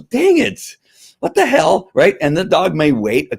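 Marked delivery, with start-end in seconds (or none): 0.0-1.9 high, put-on grumbling dog voice; the dog cursing its collar, pitched way up; annoyed dog.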